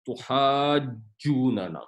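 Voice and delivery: تحاجوننا very slow, carefully joined; slow model recitation. Shown as a demonstration. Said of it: "اس کو جب اکٹھا پڑھیں گے". (تحاجوننا آہستہ جوڑ کر)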